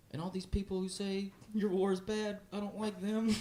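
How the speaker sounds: put-on high, slow piping child voice; a kid earnestly parroting dad's politics; mock child voice. A child repeating dad's view on the war, mocking the kid's earnestness.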